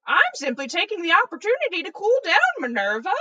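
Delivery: loud, quick, airy brush-off; carefree headmaster brushing off a scolding; breezy reply. A narrator's reading. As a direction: toss it off lightly and fast.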